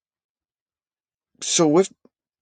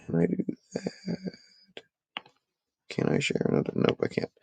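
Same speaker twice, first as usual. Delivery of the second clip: muttered aside; 8 semitones higher, 10 dB quieter than usual. Real, not acted. Muttering while trying screen share.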